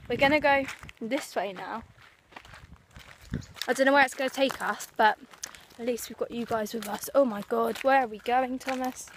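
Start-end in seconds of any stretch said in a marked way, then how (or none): none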